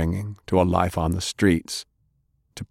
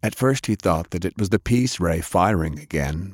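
plain reading voice

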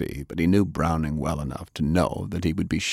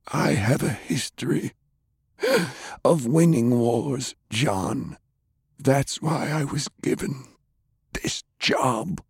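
higher, hushed voice of a weary commander; grim old commander; 8 semitones higher than usual. A narrator's reading. Commander to his friend about the war, higher and hushed. Pershing answers Browning.

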